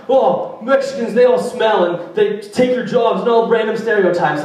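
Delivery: lightly voiced prejudiced person; a hint of a sneering bigot; mock bigot. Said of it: "people are like".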